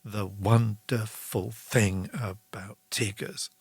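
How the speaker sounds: slow, leaning on wonderful; slow sing-song with a heavy beat on wonderful; slow stressed phrase. Shown as a demonstration. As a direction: stretch out the wonderful thing about Tigers, stressing wonderful. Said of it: "having a repetition or a stress".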